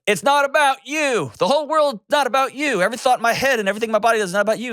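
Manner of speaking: very high-pitched imitated wife outburst; hammed-up high wail of exasperation; exasperated wife. Acting out wife's exasperated outburst.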